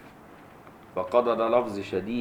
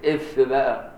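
much louder, higher mock huff; Egyptian exasperated إف; 4 semitones higher, 6 dB louder, slower than usual. Teacher imitates an annoyed Egyptian's loud إف. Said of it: "اللغة العامية بتاع المصريين".

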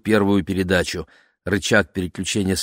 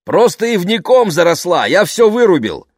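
loud, high shout over noise; shouting over engine; 10 semitones higher, 7 dB louder than usual. A narrator's reading. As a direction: yell it above the roaring motor. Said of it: "перекрикивая вой мотора".

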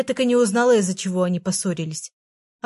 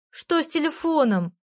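high-pitched plaintive whine; whiny, tearful; 8 semitones higher than usual. A narrator's reading. Girl worried about her phone, tearfully whining. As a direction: ask it as if about to whimper. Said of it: "плаксиво спросила я".

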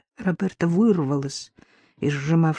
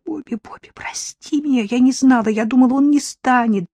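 higher, breaking apology; tearful pleading; 7 semitones higher, 4 dB louder than usual. Acted. Jillian crying to her sister, tearful. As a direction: beg forgiveness through tears. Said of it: "Джиллиан снова заплакала".

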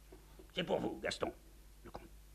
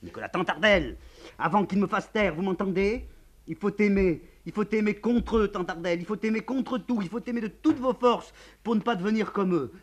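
crying out; 13 semitones higher, 9 dB louder than usual. Acted. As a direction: burst out at the whole family. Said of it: "Le comte crie soudain".

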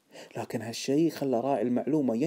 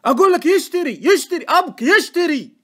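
high, loud, hammered repetition; exasperated shouting; 12 dB louder than usual. A narrator's reading. Informant insisting that he is buying, exasperated shouting. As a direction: hammer the word home furiously.

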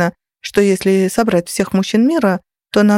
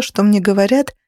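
ordinary delivery, nothing marked

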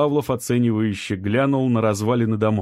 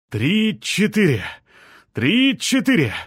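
shouted count; 9 semitones higher, slower than usual. A narrator's reading. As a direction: call the heave rhythm. Group lifting the slab.

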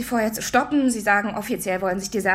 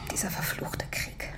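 hushed whisper; 11 dB quieter, slower than usual. Acted. Bitter late-night phone call.

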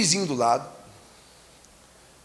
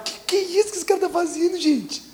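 high mock baffled neighbor voice; puzzled neighbor; 13 semitones higher than usual. Baffled neighbor squeaks high watching the other shake his tree. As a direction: squeak it out, baffled by the strange habit. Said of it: "E o outro vizinho olhava e falava".

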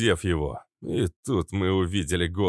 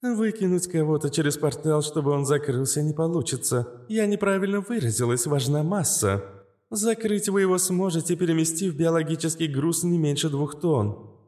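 higher, even machine-like AI; calm AI voice; 9 semitones higher than usual. A narrator's reading. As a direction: explain it like a calm AI. Astra explains.

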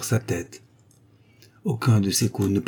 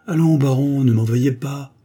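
higher and louder, teasing the baron; playful mock apology, louder; clear, mocking voice; 6 semitones higher, 5 dB louder than usual. A narrator's reading. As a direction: tease him lightly, bright and clear. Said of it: "une voix claire et railleuse".

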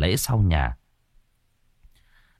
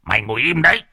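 put-on the old man snaps at him; scolding; 6 semitones higher, faster than usual. A narrator's reading. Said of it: "Ông cụ Mốc cũng cau mày". (quát mắng)

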